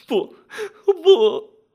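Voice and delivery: high, loud protest; frantic refusal. A narrator's reading. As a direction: refuse outright, leaping up in pitch and volume. Woman frantically refuses the offer.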